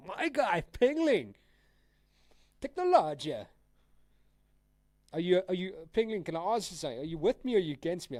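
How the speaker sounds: loud, high, fast-rising call-out to a troll; excited shouting at chat. Real, not acted. Shouting at a chatter trolling him.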